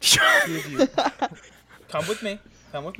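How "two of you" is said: squeaky high-pitched order; sudden high squeak. Acted. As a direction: jump way up high. Player mock-commanding voice.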